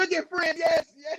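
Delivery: high excited echo; joyful echo. Real, not acted. Singer joyfully echoes the host's praise. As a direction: echo the cheer with delight.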